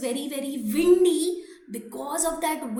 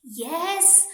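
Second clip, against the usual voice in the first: plain reading voice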